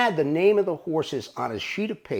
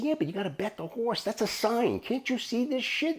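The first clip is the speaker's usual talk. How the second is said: higher-pitched, incredulous pleading; retold insistence; 8 semitones higher, 4 dB quieter than usual. Chuck argues the mistake is a sign, high insistent pleading. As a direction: imitate a friend pressing his case.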